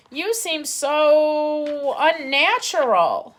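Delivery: high, loud, very slow owl screech. A narrator's reading. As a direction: drag out the owl's disgust. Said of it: "screeched the owl".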